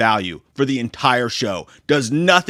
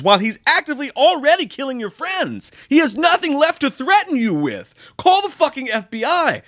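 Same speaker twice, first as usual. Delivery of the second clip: high-pitched, incredulous outburst; exasperated rant; 11 semitones higher than usual. Real, not acted. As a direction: yell sense into a clueless character. Exasperated rant at character's stupidity.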